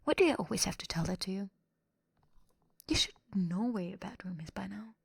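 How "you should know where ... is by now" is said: usual speaking voice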